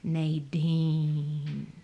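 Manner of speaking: drawn-out, musing "Nadine"; audible pondering. A narrator's reading. Man musing on his wife's name before strangers, pondering. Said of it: "this audible pondering".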